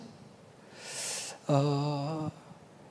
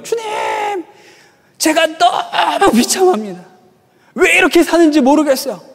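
put-on drunken wailing prayer; 9 semitones higher than usual. Drunk seminary student laments to God, wailing. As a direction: imitate a drunk bawling his misery to God. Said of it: "기도가 아니라 술주정이에요".

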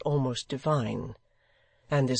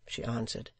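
ordinary delivery

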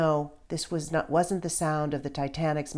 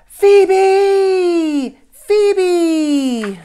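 high piercing scream, much louder; little boy's foghorn scream across a crowd; screaming a name; 15 dB louder than usual. A narrator's reading. Child screams for his lost friend, very loud and high. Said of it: "Enzo took a huge breath and screamed".

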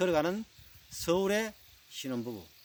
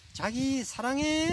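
high, drawn-out mock-sweet call; lovey-dovey Seoul husband; 9 semitones higher, slower than usual. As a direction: sing out your love to your bride, slowly and high. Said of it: "서울 신랑이 뒤따라가면서". (높고 길게 늘여 부르는 닭살 신랑)

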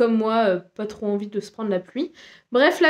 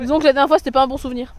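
normal talking voice

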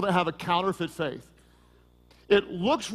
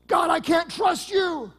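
anguished cry to God; 8 semitones higher than usual. Retold or imitated. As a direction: imitate a desperate believer calling out. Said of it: "What do you see so often?".

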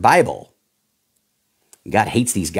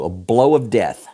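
usual speaking voice

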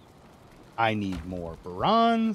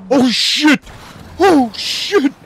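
loud, high panicked shout; panicked yell; 14 semitones higher, 11 dB louder, slower than usual. Real, not acted. Gamer yelling as the lizard wrecks his boat, loud panic. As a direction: yell it in real alarm.

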